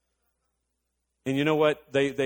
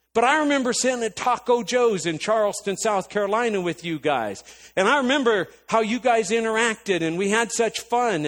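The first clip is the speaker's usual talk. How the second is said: high, loud, relentless scolding; retold tirade; 10 semitones higher, 6 dB louder than usual. Going off on sister about running to everyone's rescue, heated scolding. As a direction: reel off grievances, pitched up and forceful. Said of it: "this is probably ten minutes of a tirade".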